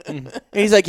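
usual speaking voice

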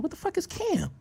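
high-pitched, soft incredulous squeak; mock bafflement. Retelling his answer to the cop about Cam, mock bafflement. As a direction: squeak it up high in comic confusion.

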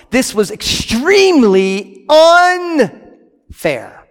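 very slow, each word stretched; slow emphatic. Real, not acted. Preacher stressing the slaves' injustice, drawn out. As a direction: drag it out to show injustice.

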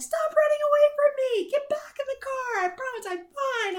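high pleading yell, imitating a drunk friend. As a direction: call after her, begging her to stop. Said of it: "Like, yelling at me".

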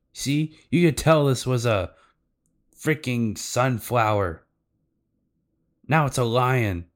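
hammed-up fed-up outburst; upset child. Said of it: "Naomi's getting real upset".

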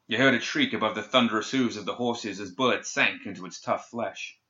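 ordinary delivery

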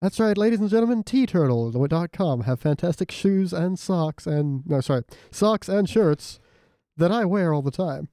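playful announcer patter with stretched product words; mock ad read. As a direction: pitch it like a podcast advert.

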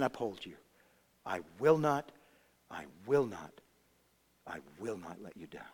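low, soft, measured assurance; assured and hushed, dying away at the end; quiet confidence. Acted. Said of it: "and confidently say".

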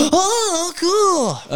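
put-on very high child voice; gasp, then a hushed-wonder kid squeal; mock childlike amazement; imitated awed little girl. Daughter's reaction to secret passageway.

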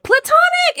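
high, loud burst of recognition; excited recall. Real, not acted. Remembering the show's name with a friend, a high happy shout. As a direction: blurt it out, thrilled to remember.

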